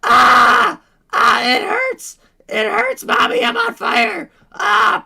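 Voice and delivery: very high, loud mock screams of pain; screaming little boy. Streamer to chat, a boy in Poland burning, hammed-up screams. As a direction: shriek like a burning child calling mommy. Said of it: "The little boy stopped screaming".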